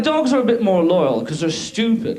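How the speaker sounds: normal talking voice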